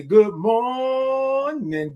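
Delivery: long, high, held MORNING; calling out a long good morning; drawn-out greeting. Real, not acted. Greeting a viewer by name.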